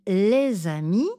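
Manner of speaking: les amis, le Z lié à amis; liaison en Z. Shown as a demonstration. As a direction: ajoutez un Z entre les et amis. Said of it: "j'ajoute un son Z". (liaison en Z dans les amis)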